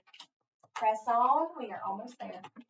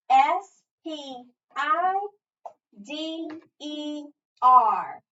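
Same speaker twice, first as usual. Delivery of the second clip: very slow, louder, higher letter names; spelled out; 4 semitones higher, 6 dB louder, much slower than usual. Shown as a demonstration. Teacher leads touch and spell, slow separate letters.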